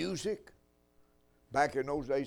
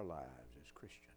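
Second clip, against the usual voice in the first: a pointed stage whisper; 12 semitones lower, 17 dB quieter than usual. Real, not acted. Preacher asking his congregation about their own pride, whispering. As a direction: drop to a whisper to make it land.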